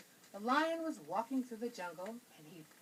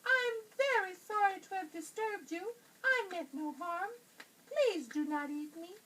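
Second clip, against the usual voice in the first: high, squeaky little mouse voice; tiny frightened mouse squeaking; 9 semitones higher, 4 dB louder than usual. A narrator's reading. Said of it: "squeaked the little mouse".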